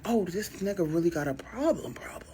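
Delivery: breathless whisper to herself; hushed aside on the phone. Retold or imitated. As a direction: whisper it, stunned. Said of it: "I'm like".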